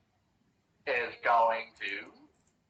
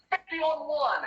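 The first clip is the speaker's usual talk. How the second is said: high goblin voice; 14 semitones higher than usual. Acted. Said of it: "he's like".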